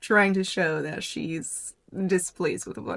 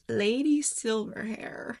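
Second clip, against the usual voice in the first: ordinary talk, nothing marked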